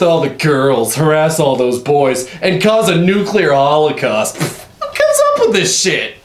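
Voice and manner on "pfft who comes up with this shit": high scoffing disbelief. Real, not acted.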